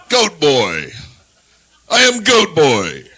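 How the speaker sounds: booming, slow, mock-mythic goat boy; loud hammed-up goat man announcement; goat boy persona. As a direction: proclaim it like a lusty satyr god.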